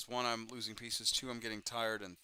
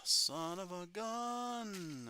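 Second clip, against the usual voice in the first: slow, high dismayed cry; drawn-out exclamation; 11 semitones higher, much slower than usual. Real, not acted. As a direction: stretch it out in dismay. Mistake while building model.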